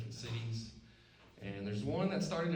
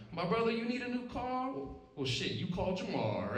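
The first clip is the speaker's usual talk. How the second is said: high pitched hammed-up lawyer pitch; 10 semitones higher than usual. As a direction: sell it like a jive ad man.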